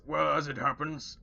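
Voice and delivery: a scheming orc offering food. Acted. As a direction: Rollo faking an orc voice to tempt the orcs. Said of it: "in his orc voice".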